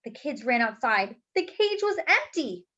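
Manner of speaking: lively, expressive read-aloud storytime voice; reading to children. A narrator's reading. Teacher reading a picture book aloud to the class, lively storytelling. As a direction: bring the picture book alive for the kids.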